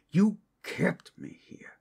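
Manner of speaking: loud, high, breathless accusing whisper; harsh whisper. Acted. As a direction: hiss the accusation loudly. Captive confronts captor.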